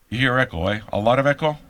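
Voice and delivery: ordinary talk, nothing marked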